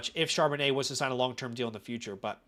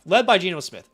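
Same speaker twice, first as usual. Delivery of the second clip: louder, sarcastic jab, fighting a laugh; holding back laughter; 5 semitones higher, 8 dB louder than usual. Shown as a demonstration. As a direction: say it mockingly, stifling a laugh. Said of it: "I'm trying not to laugh".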